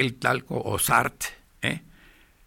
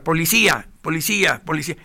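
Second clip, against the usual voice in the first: grito fuerte que va bajando; gritando policía; 4 semitones higher, 6 dB louder than usual. Retold or imitated. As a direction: pide a la policía a gritos, cada vez con menos fuerza. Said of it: "Empecé a gritar". (grito de auxilio en la calle, cada vez más débil)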